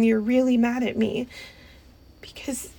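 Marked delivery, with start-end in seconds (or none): 2.2-2.7 much softer, whispered; small hurt whisper; timid whisper. Acted. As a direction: whisper it shyly. Confessing quietly to partner.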